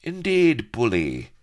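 high, loud, jaunty exclamation; breezy, high-pitched sneer. A narrator's reading. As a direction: pipe up as a cocky pirate dandy. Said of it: "Bilbo snuffed jauntily".

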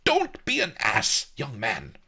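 high, loud rebuke, dropping at the end; irritated old master's outburst; angry. A narrator's reading. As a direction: scold the younger man angrily. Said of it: "Master Li said angrily".

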